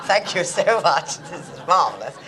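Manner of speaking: mock grateful laughing thanks. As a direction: gush through a laugh. Laughing gratitude retold.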